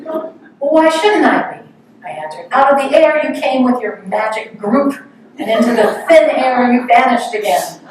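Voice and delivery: hurt disciple snapping at his guru; annoyed retort. Retold or imitated. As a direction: hurl the grievance back at him. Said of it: "You're annoyed".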